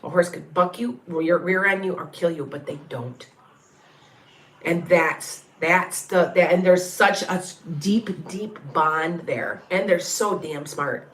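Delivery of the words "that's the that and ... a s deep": normal talking voice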